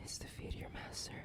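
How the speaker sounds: whispered demand to serve; hushed command. Acted. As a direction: breathe it as a command.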